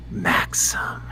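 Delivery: soft, breathy whisper; breathed. A narrator's reading. Woman answering her lover with his name, breathy whisper. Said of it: "she breathes".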